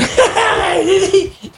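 high burst of giggling; giggling fit. Retold or imitated. Actor giggling on set during a serious take. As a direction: break into uncontrollable giggles. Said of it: "E eu fazendo aquela".

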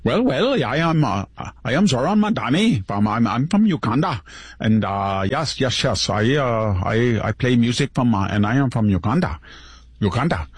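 mock an African accent, stumbling over the words; mock Ugandan accent. Pundit mocking Mamdani's interview in a hammed-up Ugandan accent. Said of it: "that accent".

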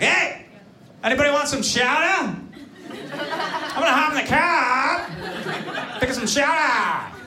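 high, hammed-up Boston accent; exaggerated high-pitched Boston townie accent. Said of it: "he always comes back talking like the place".

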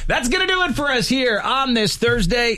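upbeat host wrapping the show; radio sign-off. Acted. Host closing the radio show, upbeat sign-off.

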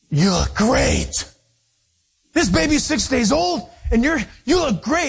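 gushing stewardess; mock gushing compliment. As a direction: praise the new mother effusively.

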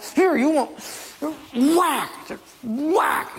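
high-pitched acted fury with each blow; angry Moses. Moses striking the rock for water, angrily. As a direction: punch out each whack.

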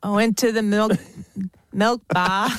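American badly faking an Australian accent; halting, unconvincing try at an Aussie accent; attempted Aussie accent. Shown as a demonstration. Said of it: "I'm so bad".

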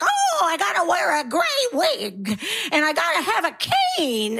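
hint of a wobbly granny voice; mock old-lady act. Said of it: "they perform an old lady".

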